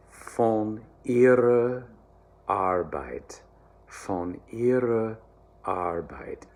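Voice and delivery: slow, careful German, the phrase twice; German diction demo. Shown as a demonstration. Choir coach modelling the phrase, slow careful German.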